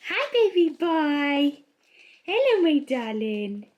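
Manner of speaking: slow, high cooing to a pet. Real, not acted. Cooing to her dogs.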